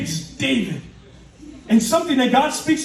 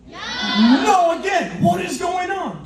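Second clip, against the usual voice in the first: exasperated disbelief; 7 semitones higher, slower than usual. Acted. Acting exasperation at repeated refusals.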